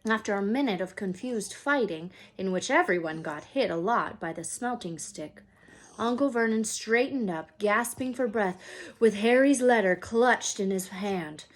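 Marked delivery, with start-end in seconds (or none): none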